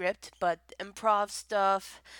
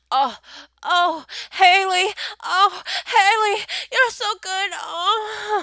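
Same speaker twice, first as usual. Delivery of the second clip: loud, high, hammed-up moans; exaggerated high cries of pleasure; wild mock moaning; 10 semitones higher, 11 dB louder than usual. Shown as a demonstration. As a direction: imitate a partner going wild. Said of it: "moan my name or go really wild".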